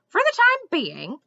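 high, loud, incredulous echo; indignant. A narrator's reading. Squirrel repeating Mendenbar's answer back, indignantly. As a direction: throw it back as an outraged question. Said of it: "the squirrel said indignantly".

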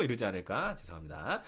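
ordinary delivery

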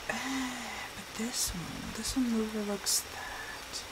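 soft murmur over the choice; quiet thinking aloud; murmured to herself. Real, not acted. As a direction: say it under your breath. Picking trees in game, murmuring.

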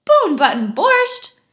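loud, high, slow echo; incredulous question. A narrator's reading. Shamus echoing the beggar's odd recipe, incredulous. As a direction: repeat it in drawn-out disbelief. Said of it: "asked the Shamus".

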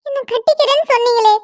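high, tearful begging voice; tearful pleading. Acted. Jilted woman begs the groom who abandoned her, tearful and high. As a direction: plead with him through rising tears.

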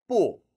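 short 뿌 falling sharply from high; fourth tone. Shown as a demonstration. Teacher calling 사성, 뿌 in a falling tone. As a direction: drop 뿌 hard from a high, loud start. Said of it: "사성".

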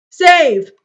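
high, loud shout of save; sudden loud outburst. Real, not acted. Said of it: "that was loud".

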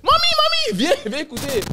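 imitation d'une sœur qui crie, très aiguë; appel excité. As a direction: appeler maman à tue-tête, tout excitée. Sœur appelle leur mère, histoire racontée. (appel excité et aigu à sa mère)

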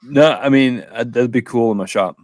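usual speaking voice

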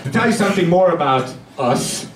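normal talking voice

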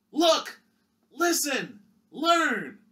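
stirring rally cry; rousing shout. Acted. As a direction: whip the kids up with each word. Hero shouts the three L's.

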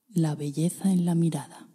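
clear, measured program announcement; announcer intro. Acted. School introducing its practice to the listener, measured announcer read. Spoken intro before meditation.

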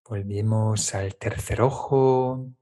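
usual speaking voice

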